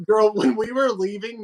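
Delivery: normal talking voice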